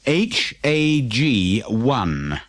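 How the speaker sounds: very slow, letter by letter; reading the number plate; spelled out. A narrator's reading. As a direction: spell out H A G one slowly. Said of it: "spelling plate".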